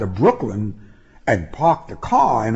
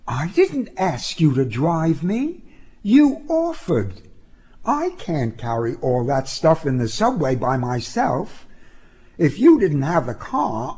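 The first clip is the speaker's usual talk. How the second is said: indignant female voice, pitched up; high-voiced young woman, defensive; 7 semitones higher than usual. A narrator's reading. Passenger defending herself to her driver, high and indignant.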